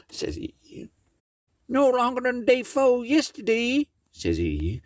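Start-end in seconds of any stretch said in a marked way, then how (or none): 1.7-3.9 pitched well up for a critter in the tale; high character voice. Acted. Brer Tarrypin telling Miss Meadows the news, high character voice.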